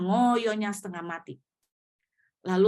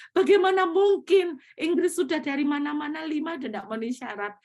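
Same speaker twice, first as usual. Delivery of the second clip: amazed lawyer; 8 semitones higher, 5 dB louder than usual. Retold or imitated.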